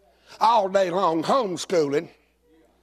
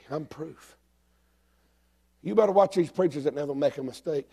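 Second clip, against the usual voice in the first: ordinary talk, nothing marked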